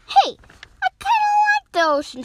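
very high, loud little squeak of a voice; octopus voice. Acted. Octopus approves of the name, very high quick voice. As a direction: pipe up quickly in a high pitch.

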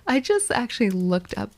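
normal talking voice

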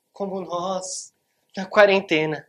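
slow, rising to a loud punchline; playful aside. Real, not acted. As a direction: tease the audience about lockdown. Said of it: "joke about quarantine". (piada arrastada que explode alto)

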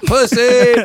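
mocking taunt.

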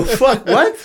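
high-pitched, incredulous comeback; retold disbelief. Retelling his reaction to a blunt office question, incredulous. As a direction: act out being taken aback.

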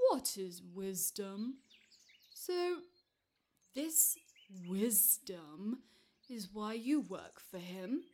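curious giant. Acted. Narrator voices the curious giant.

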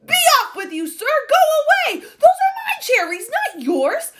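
high, loud, frantic shouts; furious shouting. A narrator's reading. Duke raging at bird stealing his cherries, furious shouting. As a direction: yell in outrage at the top of the voice. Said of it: "the Duke was shouting".

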